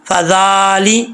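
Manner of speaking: drawn-out Quranic फ़ज़ाली कल्लादी; careful tilawat; slow recitation. Shown as a demonstration. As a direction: chant कल्लादी slowly. Recites the word slowly.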